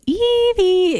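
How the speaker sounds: put-on high, sing-song baby talk; cutesy baby voice. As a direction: coo the name like to a baby. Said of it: "say it in a baby voice".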